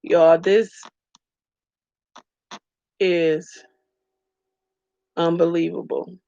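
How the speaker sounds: fighting back real tears mid-sentence; real tearful. Real, not acted. Telling her viewers the news, tearfully choked up. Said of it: "Y'all have me on here crying".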